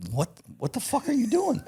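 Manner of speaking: very high, squeaky mock outburst; incredulous dad. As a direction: imitate an appalled father, voice shooting way up. Acts out his stunned dad.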